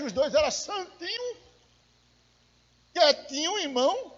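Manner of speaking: high, cutesy little-kitten voice; mock-sweet innocence. Preacher mocking a couple who act saintly in church, mock-sweet.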